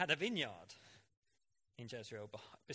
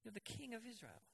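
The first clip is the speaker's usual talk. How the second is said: much higher, pushy coaxing wife voice; Jezebel goading; 9 semitones higher, 4 dB louder than usual. Retold or imitated. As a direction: egg the sulking king on, high and insistent. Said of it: "and then she goes".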